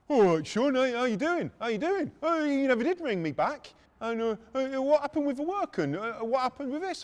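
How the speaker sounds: high, loud, over-keen hail; loud, high-pitched mock friendliness; eager doorman greeting. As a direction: imitate a pushy old acquaintance calling out.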